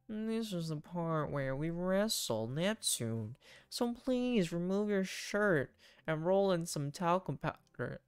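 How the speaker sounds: slow, mock-solemn captain; leisurely playful ceremony; joking captain dad. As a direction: draw out the silly instructions. Voices Joseph in the game.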